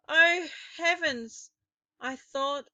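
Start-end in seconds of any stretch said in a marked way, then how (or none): 0.1-1.5 fearful gasp. A narrator's reading. Narrator's terror in witch room.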